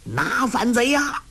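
rally the soldiers; rallying shout. Acted. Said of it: "叫道".

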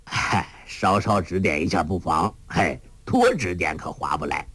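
lightly voiced old man, slightly low; a hint of a jovial old beggar coaxed by good food; old Hong Qigong, teasing and pleased; old beggar master. A narrator's reading.

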